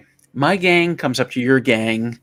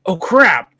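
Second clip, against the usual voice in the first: mock alarm; 5 semitones higher, 5 dB louder than usual. Joke hero sees building on fire.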